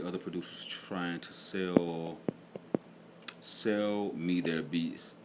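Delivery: slow drawn-out. Acted. Mocking other producers, slowed.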